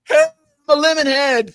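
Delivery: loud high yell across the crowd; retold scream. Fan screaming her greeting at Don in public, loud high yell. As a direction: belt it out so everybody hears. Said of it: "She screamed".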